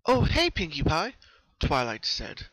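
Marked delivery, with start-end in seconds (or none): 0.1-1.1 high, louder friendly greeting; surprised and happy. Acted. Twilight opening the door to her friend, bright surprised hello. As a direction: pleasantly caught off guard. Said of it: "surprised but happy to see her friend".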